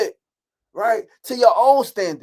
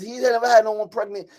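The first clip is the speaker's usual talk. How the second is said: usual speaking voice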